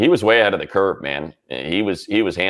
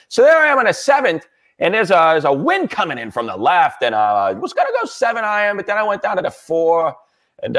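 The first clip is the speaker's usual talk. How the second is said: high, nasal-free mock old broadcaster rambling; retelling a golf round in a pitched-up mock voice; Mad Dog impression; 10 semitones higher than usual. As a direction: ham up a self-absorbed golf ramble, pitched way up. Imitating Mad Dog's golf story.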